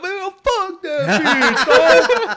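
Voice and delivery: high, slow, laughing through it; mock outrage dissolving into laughter; laughing quote. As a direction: imitate the friend while cracking up. Friend's quote, told laughing.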